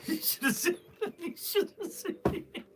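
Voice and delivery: high, breathy giggle through the words; laughing. Real, not acted. Seller to chat, helpless laughter. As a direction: squeal it out while cracking up. Repeating the joke, cracking up.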